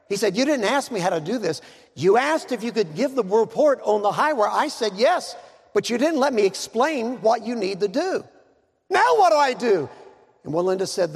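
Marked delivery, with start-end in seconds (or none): 0.4-9.9 high, acted exasperated pleading; agitated. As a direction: build to an alarmed demand on the last question. Acted quarrel on the high wire.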